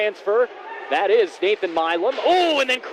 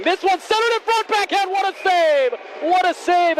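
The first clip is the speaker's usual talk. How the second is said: high, excited save call; 8 semitones higher than usual. Real, not acted. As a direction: shout out the big save. Big save call in play-by-play.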